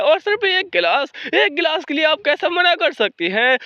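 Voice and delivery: high, pleading lady's complaint; high whining voice over just one glass; whiny high protest. Acted. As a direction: whine it like a spoiled noblewoman. Lady protests about one glass.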